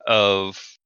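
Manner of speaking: ordinary talk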